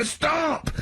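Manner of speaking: squealed mock scream. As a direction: imitate a panicked passenger's yelp. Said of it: "he'd scream and go".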